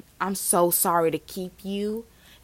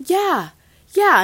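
imitated friend agreeing; 11 semitones higher, 5 dB louder than usual. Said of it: "He would be like".

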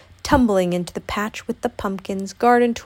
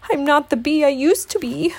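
high, breath-starved bear voice; pant, then push the words out; gasping between pants; 6 semitones higher, 3 dB louder than usual. A narrator's reading. Pooh runs from the bees, panting. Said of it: "gasped Pooh between pants".

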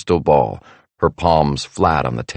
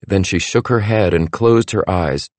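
ordinary delivery

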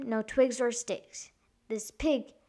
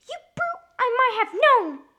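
high squeal rising to a shout; squeaky storybook pig outburst; crying-out pig; 14 semitones higher, 8 dB louder than usual. A narrator's reading. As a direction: cry out indignantly as the piglet. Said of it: "Pig cried".

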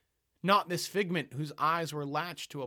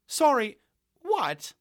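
high, loud disbelieving outburst; exasperated cry; 11 semitones higher, 9 dB louder, slower than usual. Acted. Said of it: "Chloe cried in ultimate exasperation".

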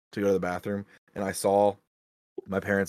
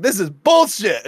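high, loud, slow indignant outburst; retold kid fury; 9 semitones higher, 8 dB louder, slower than usual. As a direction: blurt it out like a betrayed child. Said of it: "I'm fucking furious".

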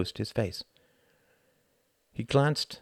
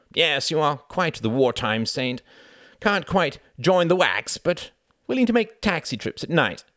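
sarcastic jeer; 8 semitones higher, 5 dB louder than usual. A narrator's reading. Reginald needling Wendy over her war work, sarcastic. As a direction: draw out the scornful praise, pitched high.

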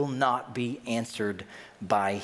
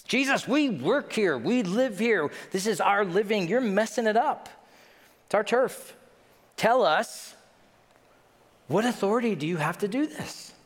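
aggrieved protest; 8 semitones higher than usual. Acted. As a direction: defend your turf, outraged. Leaders protesting their turf.

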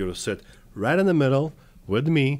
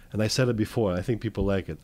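ordinary talk, nothing marked

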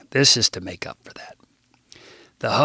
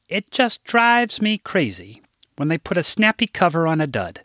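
higher, lightly voiced older woman; a mild hint of a prim, fussy secretary; lightly played prim office manager; 6 semitones higher than usual. Narrator voices Rose.

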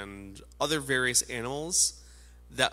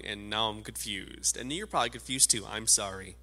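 ordinary talk

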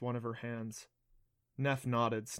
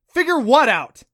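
high, loud, angry question; loud demand; 12 semitones higher, 17 dB louder than usual. A narrator's reading. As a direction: bark the question out in grief. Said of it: "he demanded loudly".

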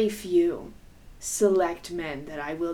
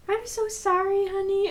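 high, soothing sister voice; mock-tender older sister, much higher; comforting sister; 13 semitones higher than usual. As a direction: coo it like a big sister on the phone.

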